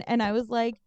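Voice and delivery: ordinary talk, nothing marked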